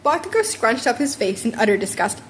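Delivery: plain reading voice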